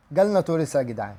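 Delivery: ordinary talk, nothing marked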